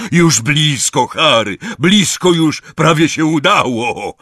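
high, loud, excited; shouted encouragement. A narrator's reading. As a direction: cheer your friend on over the engine. Hagrid shouts encouragement during flight.

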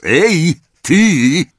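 loud, high bellowing call; booming shout. A narrator's reading. Uncle bellowing up the stairs, loud and booming. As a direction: roar up the stairs at the boy. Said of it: "zagrzmiał głos".

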